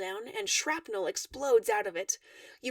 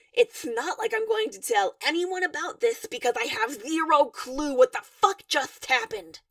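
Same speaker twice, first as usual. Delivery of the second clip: shouting; 6 dB louder than usual. A narrator's reading. Cornered stranger shouting at Durka and Dave, louder. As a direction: yell it out in baffled frustration. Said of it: "you shout".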